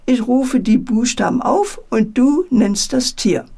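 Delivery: ordinary delivery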